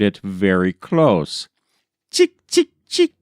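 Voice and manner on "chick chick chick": high chirping call; bird chirp. A narrator's reading. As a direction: chirp it sharply like a small bird. Creature's call in story.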